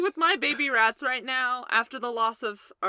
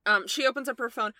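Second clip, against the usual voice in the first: normal talking voice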